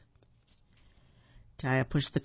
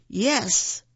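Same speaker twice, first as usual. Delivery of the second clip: high, stubborn shout back; yelled insistence; 7 semitones higher, 4 dB louder than usual. A narrator's reading. Cashier arguing with the father, yelled insistence. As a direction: shout it back, higher-pitched. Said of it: "The man yelled".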